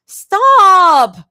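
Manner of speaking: high, loud whining wail; whine. A narrator's reading. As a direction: whine it out, flustered. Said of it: "Zoe whines".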